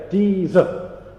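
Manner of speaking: "Deezer" slowly, with a clear Z and no R at the end; Deezer demo. Shown as a demonstration. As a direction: say "Deezer" like the app. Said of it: "that's what I'd like you to say".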